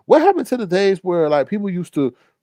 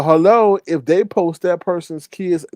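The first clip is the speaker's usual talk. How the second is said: usual speaking voice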